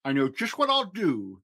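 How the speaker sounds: usual speaking voice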